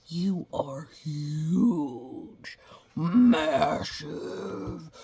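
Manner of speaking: slow hushed then loud massive; building to a loud massive; emphatic hugeness. Real, not acted. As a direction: stretch out how huge you are. Stressing children's size.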